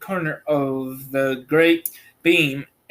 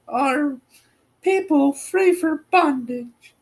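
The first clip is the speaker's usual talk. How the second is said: put-on high, lilting old woman's voice; little old woman; 12 semitones higher than usual. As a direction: voice her as a tiny kindly old woman.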